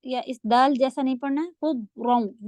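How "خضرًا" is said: خضرًا slow, with heavy خ, ض and ر; heavy letters. Shown as a demonstration. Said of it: "بہت heavy". (خضرًا بھاری اور آہستہ)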